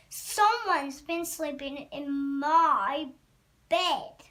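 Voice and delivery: loud Papa Bear voice; great huge voice. A narrator's reading. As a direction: boom the complaint, landing hard on the last word. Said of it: "in his great huge voice".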